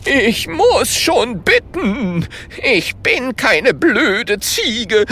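high, slow, offended protest; indignant. A narrator's reading. Holunder rebuking the ghost, indignant. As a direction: draw it out in outrage. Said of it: "sagt empört".

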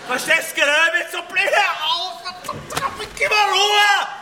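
building to a high, loud shout; irritated rant. Acted. Ranting about wife's touch. (steigernd lauter, hoher Ausbruch)

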